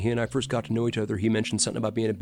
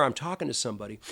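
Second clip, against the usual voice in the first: usual speaking voice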